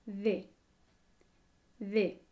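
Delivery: De with the tongue tip lightly between the teeth; De said slowly with a soft dental D; Spanish D demo. Shown as a demonstration. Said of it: "El sonido de la D española".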